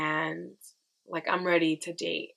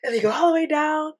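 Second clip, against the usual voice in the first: higher and louder, thrilled retelling; excited; 10 semitones higher, 9 dB louder than usual. Retold or imitated. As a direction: ride the drop with your voice, high and loud. Retelling the coaster drop.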